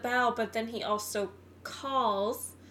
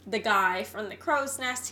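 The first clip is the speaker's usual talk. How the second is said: normal talking voice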